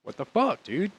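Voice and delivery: high, outraged mock yell; furious outburst. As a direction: blurt it out in disbelief at the break-in. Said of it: "So now I'm like".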